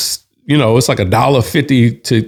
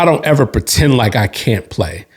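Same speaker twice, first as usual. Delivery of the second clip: plain reading voice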